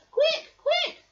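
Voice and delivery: very high, urgent bird chirp. Acted. Bird calls friends to help, very high chirping. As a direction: chirp the alarm. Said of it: "she chirped".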